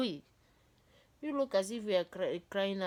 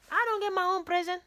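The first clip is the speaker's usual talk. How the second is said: much higher and louder mock complaint; mock indignant; 13 semitones higher, 8 dB louder, faster than usual. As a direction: whine it like a cheated kid, high and loud. Comic complaint about no present.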